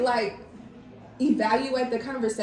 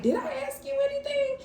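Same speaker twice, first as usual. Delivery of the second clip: high, quick mock snap; mock indignant comeback; sassy retort; 14 semitones higher, faster than usual. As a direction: fire it back like she's overstepped. Snapping back at mom, retold.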